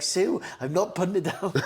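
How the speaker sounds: high, giggling refusal; laughing dad. Retold or imitated. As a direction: laugh through the line. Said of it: "He'd be like".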